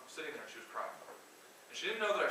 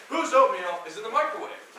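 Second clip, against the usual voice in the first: loud, higher shout at the whole office; angry repeated demand; 8 semitones higher, 12 dB louder than usual. Retold or imitated. Owner yelling across the office for the oatmeal's owner, loud angry shout. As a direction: bellow it a second time, louder now. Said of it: "So then he asked again".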